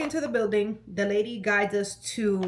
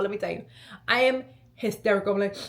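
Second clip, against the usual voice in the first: ordinary delivery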